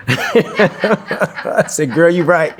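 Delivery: delighted laugh, then tease her fondly; laughing agreement. Retold or imitated.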